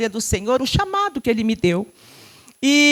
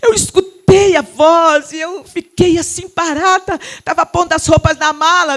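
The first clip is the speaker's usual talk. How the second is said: louder, higher, voice catching on tears; tearful telling; 5 semitones higher, 4 dB louder than usual. Real, not acted. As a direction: relive the moment, near crying. Testimony, voice breaking. (emocionada, quase chorando)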